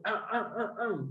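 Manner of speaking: repeated soft throat-clearing noises. Shown as a demonstration. Teacher showing what sounds horrible on a microphone, mock throat clearing. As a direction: imitate clearing something stuck in the throat. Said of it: "porque tengo algo acá".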